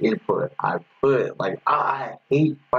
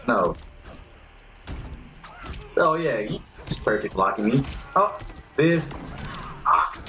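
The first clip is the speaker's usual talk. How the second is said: ordinary delivery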